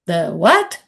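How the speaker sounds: retold disbelief, sharply rising question; incredulous. Manager hearing the team's nickname for her, incredulous. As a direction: repeat it back in stunned disbelief.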